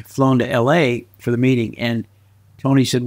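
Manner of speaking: ordinary talk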